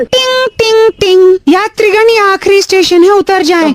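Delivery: recorded metro announcer. Pretend. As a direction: play the automated metro voice. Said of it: "वो recorded आवाज है भाई".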